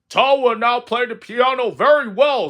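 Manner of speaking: loud, high stage announcer; strange announcer voice. Acted. Strange voice introduces Toad's piano act, loud grand announcement. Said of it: "said the strange voice".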